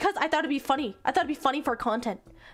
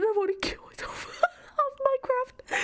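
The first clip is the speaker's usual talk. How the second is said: breathy whisper cracking into laughter; laugh-whisper in mortified despair; 10 semitones higher than usual. Real, not acted. Embarrassed outburst over screenshot.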